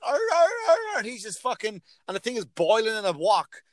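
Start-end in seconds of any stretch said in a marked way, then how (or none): 0.0-1.0 high, loud mock dog yelps; imitated yelping dog. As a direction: yelp like a hurt dog. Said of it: "And the dog is like".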